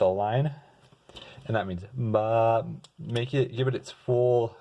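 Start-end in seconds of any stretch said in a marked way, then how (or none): 1.9-2.7 long, slow held syllable; drawn-out, sustained note demo; tenuto. Shown as a demonstration. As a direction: hold the note for its full value. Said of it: "make it kinda long and slower".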